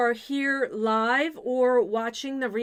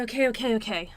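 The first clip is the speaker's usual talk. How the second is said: ordinary talk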